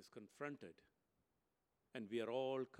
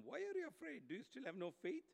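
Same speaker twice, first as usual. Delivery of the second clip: Jesus rebuking; 11 semitones higher than usual. Acted.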